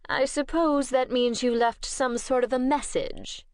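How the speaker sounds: a scowling master who expects obedience; stern vampire lord. Acted. Bishop scowls, cold vampire lord.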